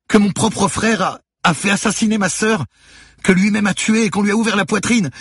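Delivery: very high, loud hysterical screaming. A narrator's reading. Manon learning her brother had her sister killed, screaming. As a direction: scream it out in anguish, barely able to go on. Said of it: "se mit à hurler".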